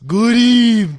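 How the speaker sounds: high, loud Dracula greeting; vampire voice. Pretend. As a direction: greet like a hammy count. Playing the vampire roommate.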